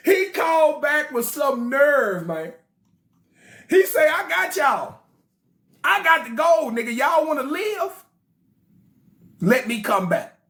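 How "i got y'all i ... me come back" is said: cocky threat. Acted. Olalu's voicemail.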